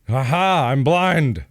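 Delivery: loud, high mock cry; playful loud outburst; mock outcry. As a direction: call out as if dazzled. Mock reaction to dazzling picture.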